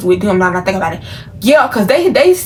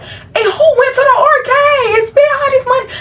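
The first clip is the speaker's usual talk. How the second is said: much higher, louder cry of disbelief; mom's shocked shout; 4 dB louder than usual. Retold or imitated. Mother in the car discovering arcade charges, shrieking. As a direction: yell it like mom reading the charges off her phone. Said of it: "She like".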